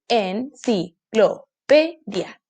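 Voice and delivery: "enciclopedia" slowly split into separate beats; slow, deliberate syllables in "enciclopedia". Shown as a demonstration. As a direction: chop "enciclopedia" into EN-CI-CLO-PE-DIA. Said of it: "separación de las palabras cada vez".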